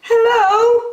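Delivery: very high, loud sing-song hello; high bird call. Acted. Lazy bird calling to the passing elephant, high. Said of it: "called the lazy bird".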